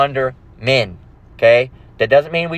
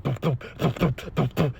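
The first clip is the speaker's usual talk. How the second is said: soft thumping heartbeat noises; cartoon heartbeat; 2 semitones lower, 10 dB quieter than usual. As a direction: thump out a pounding heart under your breath. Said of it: "you'd see the heart".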